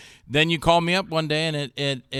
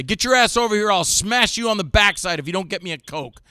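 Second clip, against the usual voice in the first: louder, higher, barking orders for a Coke; hammed-up tough-guy demand; mock bossy yell; 5 semitones higher, 6 dB louder than usual. Imitating Rod bossing crew for a Coke, mock yelling.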